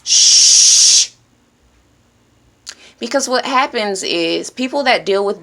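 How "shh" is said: a long unvoiced shh; hushing shh. Shown as a demonstration. Self-help vlogger shows how to hush the mind, hissed shh. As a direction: hush yourself sharply. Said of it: "telling yourself to be quiet".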